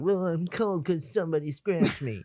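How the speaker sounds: high, silly mock whimper; imitating movie character. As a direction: whine it in a put-on high voice. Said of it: "he's like".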